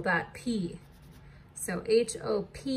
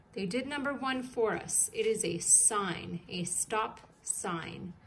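normal talking voice

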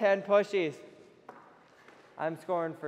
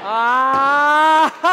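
loud cry into laughter; shout, then laugh it off; loud surprised yell and laugh; 7 semitones higher, 13 dB louder than usual. Real, not acted. Goalie saves again.

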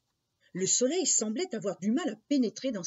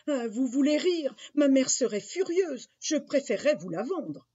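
put-on higher, louder child's protest; indignant boy; 6 semitones higher, 5 dB louder than usual. Jacques refuses to swap the cow for beans, indignant. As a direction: object as an affronted little boy.

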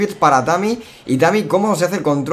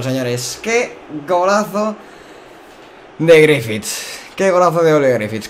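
breakneck goal commentary; 5 semitones higher, 4 dB louder than usual. Real, not acted. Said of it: "goal celebration".

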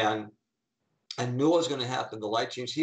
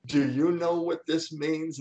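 excited; 4 semitones higher than usual. Retold or imitated. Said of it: "her face just lit up".

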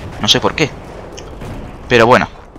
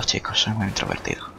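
whispered, much softer confession of shyness; playful bashful whisper; mock shy whisper; 12 dB quieter than usual. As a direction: hush it like a timid introvert. Joking about being shy.

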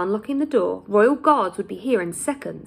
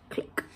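soft click sound; 13 dB quieter than usual. Acted. Lock sound effect in story.

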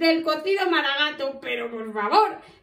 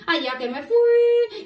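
much higher, stretched-out last word; "fui" held long and high; high, drawn out; 7 semitones higher, 4 dB louder, slower than usual. Acted. Cuquín tells children of setting off, high and drawn out. Repeats it stretched to show journey.

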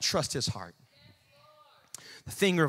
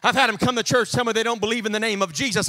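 ordinary delivery

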